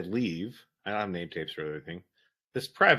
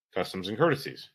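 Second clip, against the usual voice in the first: ordinary talk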